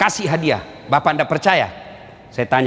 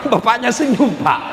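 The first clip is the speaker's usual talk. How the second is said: ordinary delivery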